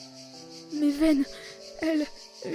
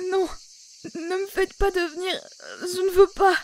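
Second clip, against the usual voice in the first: pleading through tears; crying plea. Acted. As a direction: sob out the begging words. Nightmare, child begging.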